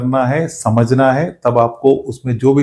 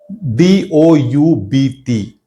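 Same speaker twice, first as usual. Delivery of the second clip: very slow, letter by letter; spelled out slowly; much slower than usual. Shown as a demonstration. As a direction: dictate each letter of "D O यू B T" with a gap between them. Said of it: "ऐसे हम इसको लिखते हैं।". (अक्षर-अक्षर धीरे-धीरे बोलें)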